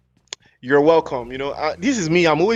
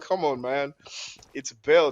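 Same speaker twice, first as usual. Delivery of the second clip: ordinary talk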